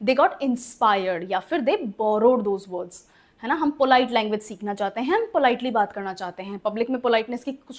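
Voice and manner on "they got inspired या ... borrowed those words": slow correction. Shown as a demonstration. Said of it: "ऐसा नहीं होता है".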